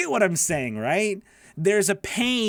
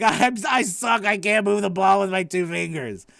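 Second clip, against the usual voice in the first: mock despair; 3 semitones higher, 4 dB louder than usual. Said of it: "'cause it's like".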